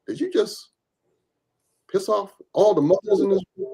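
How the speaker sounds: high, incredulous question; shocked disbelief. Retold or imitated. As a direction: ask like you can't believe what he just did. Colleagues shocked at his remark.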